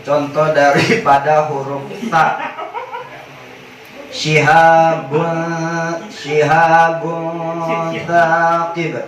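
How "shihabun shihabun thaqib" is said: slow drawn-out "Shihabun, shihabun thaqib" with the nun hidden midway; slow tajwid recitation. Shown as a demonstration. Teacher demonstrating ikhfa for students, slowly drawn. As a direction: stretch each syllable like Quran recitation. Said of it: "bacanya kayak tadi".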